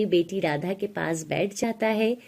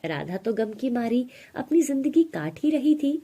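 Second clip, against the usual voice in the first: ordinary delivery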